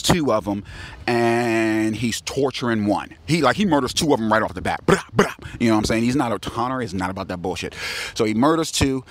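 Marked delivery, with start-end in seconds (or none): 4.8-5.3 two sharp high bursts; imitated gunshots. He murders two right off the bat, imitated shots. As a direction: fire off two vocal gunshots.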